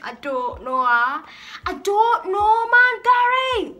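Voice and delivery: loud, high, drawn-out Geordie yell; Geordie accent. Shown as a demonstration. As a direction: holler it in an exaggerated Geordie accent. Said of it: "mix it with the Geordie accent".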